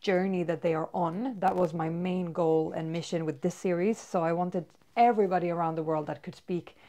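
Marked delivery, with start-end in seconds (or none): none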